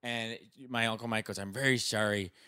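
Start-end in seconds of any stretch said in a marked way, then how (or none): 1.4-2.3 low South Side Irish Chicago condolence; South Side Irish Chicago accent. Retold or imitated. Uncle consoling after a death, South Side Irish Chicago accent. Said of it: "He's got the South Side Irish Chicago accent".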